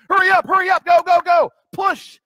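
loud, high coach yelling at players; football coach. Retold or imitated. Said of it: "It sounds like my football coach".

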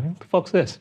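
puzzled devil, high-pitched. Retold or imitated. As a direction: voice the devil confused. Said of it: "just the devil walking through hell, like".

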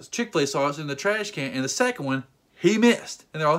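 normal talking voice

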